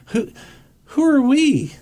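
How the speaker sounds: who are we to tell them, incredulous; incredulous outrage. Real, not acted. Outraged rhetorical question.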